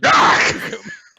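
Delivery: loud, harsh mock scream; pained scream. Recapper acts the boy stabbed in the foot, pained scream.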